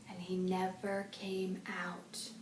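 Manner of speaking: slow, soft scary whisper; spooky whisper. A narrator's reading. Buster tells friends the scary rumor, whispered. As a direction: whisper it to give them chills.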